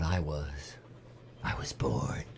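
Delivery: low eerie whisper; spooky whisper. Acted. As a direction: whisper it like a creepy confession. Spooky joke about the murders.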